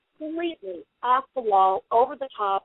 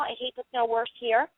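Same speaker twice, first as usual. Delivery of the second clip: usual speaking voice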